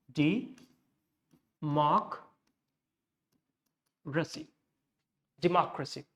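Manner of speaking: democracy in separated pieces, dee... MOCK... ruh-see, then whole; democracy taken apart around a stressed MOCK; stress pattern demonstrated. Shown as a demonstration. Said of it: "So the graph would go like".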